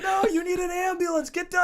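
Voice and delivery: high-pitched worried insistence; insistent lady. Retold or imitated. Lady to the injured rider, high insistent urging.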